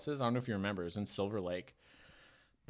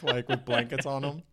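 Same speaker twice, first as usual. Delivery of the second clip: ordinary talk, nothing marked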